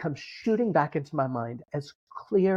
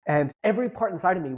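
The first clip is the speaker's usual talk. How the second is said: ordinary delivery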